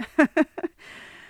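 a sudden high laugh of emotion; real emotional laugh. Real, not acted. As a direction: laugh through being moved. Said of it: "I'm already getting emotional".

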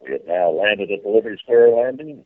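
boat landing announcement over the PA; airport announcement. Pretend.

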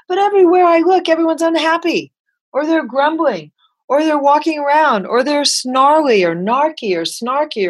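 high, fast, indignant rant; worked-up complaining. Retold or imitated. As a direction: complain with rising exasperation. Grumpy rant about Parisians.

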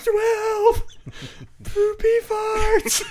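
very high, loud mock squeal; imitated hysterical caller. Imitating friend losing it on the phone, loud hysterical squeal. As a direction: wail it like someone helpless with laughter. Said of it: "this is all I hear is".

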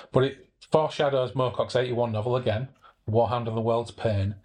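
ordinary delivery, nothing marked

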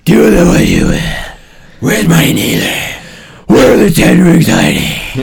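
low, loud menacing villain shout; Mandarin villain voice. Retold or imitated. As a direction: snarl it like a villain. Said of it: "That's a very breathy voice".

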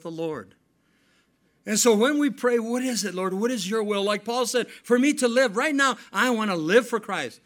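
loud, high preacher's cry; full-force preaching. Acted. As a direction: lift the church with it.

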